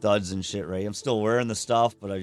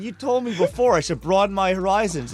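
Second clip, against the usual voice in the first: exasperated retort; 8 semitones higher than usual. Retold or imitated.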